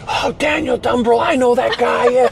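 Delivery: imitating an excited young American; excited recognition. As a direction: gush like you've spotted a celebrity.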